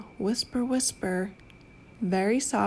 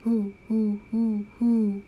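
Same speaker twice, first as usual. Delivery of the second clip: owl hoot; slower than usual. Pretend. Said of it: "He can sound like an owl".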